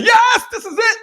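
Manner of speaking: high laughing mock elation; hammed-up excitement with a laugh. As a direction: play the overexcited convert. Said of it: "get excited and say".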